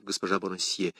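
ordinary delivery, nothing marked

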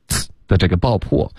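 normal talking voice

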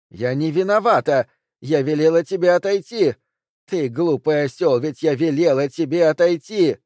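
loud defensive yell; shouting back. A narrator's reading. Wife yells at her wounded husband, defensive shout. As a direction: throw the blame back at him.

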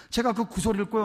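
normal talking voice

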